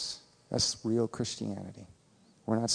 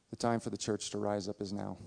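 ordinary talk

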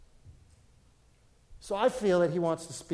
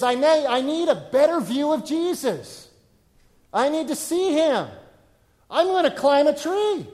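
high, loud, eager resolve; determined excitement; 9 semitones higher, 7 dB louder than usual. Retold or imitated. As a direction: burst out with determination. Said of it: "he wasn't focused on obstacles".

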